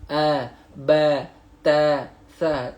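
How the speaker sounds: "A, ba, ta, sa" with fronted ae vowels; Arabic-accent vowels. Shown as a demonstration. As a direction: smile through "A, ba, ta, sa".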